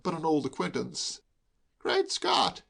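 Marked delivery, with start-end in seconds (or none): 1.8-2.6 high, startled exclamation; exclaiming. A narrator's reading. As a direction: burst out in delighted surprise. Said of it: "he exclaimed".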